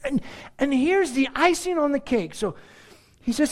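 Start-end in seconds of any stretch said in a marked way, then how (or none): none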